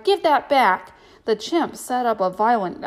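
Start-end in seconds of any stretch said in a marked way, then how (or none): 0.0-0.9 shouting. A narrator's reading. Boy grabs thieving chimp.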